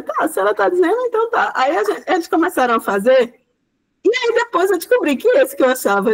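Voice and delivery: usual speaking voice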